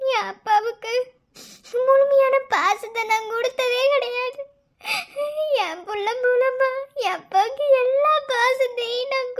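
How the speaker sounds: crying through the words, voice rising and breaking; tearful pleading. Acted. Bride crying to her fiancé about her late father, tearful. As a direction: weep as you beg to see your father again. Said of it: "இப்ப எதுக்கு அழுதுகிட்டு இருக்க?".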